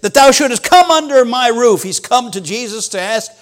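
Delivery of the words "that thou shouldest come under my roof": pleading centurion. Acted. Voicing humble centurion.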